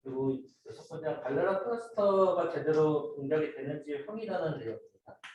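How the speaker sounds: normal talking voice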